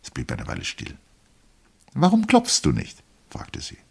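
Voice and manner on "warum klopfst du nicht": very high woman's voice; wife scolds husband for not knocking, very high; wife's reproach. A narrator's reading.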